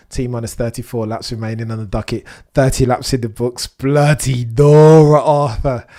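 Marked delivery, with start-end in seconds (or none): 3.7-5.9 slow, louder, stretched-out bloody nora; long loud exasperated Bloody Nora; drawn-out exclamation. Real, not acted. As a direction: drag out bloody Nora in disbelief. Exclamation at chaotic race.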